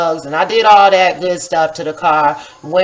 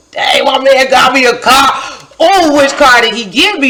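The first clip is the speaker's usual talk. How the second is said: higher, louder, thrilled exclamation; gushing over which fancy car is hers; excited; 6 semitones higher, 4 dB louder than usual. Retold or imitated. Thrilled at seeing fancy cars.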